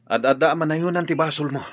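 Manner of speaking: a friend shocked into a loud rebuke; alarmed rebuke. Acted. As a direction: warn him sharply. Friend rebukes talk of shooting.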